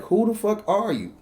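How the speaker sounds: high, incredulous question; amazed disbelief. Retold or imitated. Man telling of the woman's Sega, amazed. Repeats his amazed reaction.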